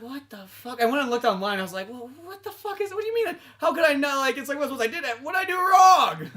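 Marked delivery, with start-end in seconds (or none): 0.0-0.8 hushed, higher-pitched shock; stunned disbelief. Retold or imitated. Player finding his whole crew killed, stunned. Recalls discovering the massacre in game. 1.9-6.3 incredulous protest. Retold or imitated. Player learning why the crew died, mock-outraged bewilderment. Protests the bad ending he found.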